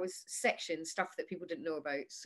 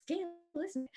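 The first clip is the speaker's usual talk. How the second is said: air hostess; 10 semitones higher, 3 dB quieter than usual. Retold or imitated. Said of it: "She was like".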